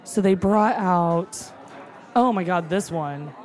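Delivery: normal talking voice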